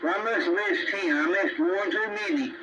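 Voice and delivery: usual speaking voice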